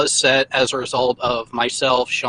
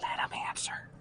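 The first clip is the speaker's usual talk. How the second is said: whispering; 18 dB quieter, faster than usual. Real, not acted. Urging quietly during a rant.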